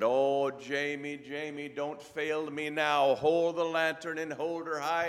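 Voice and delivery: mock-urgent Scottish doctor; Scottish brogue. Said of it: "best Scottish brogue I can come up with".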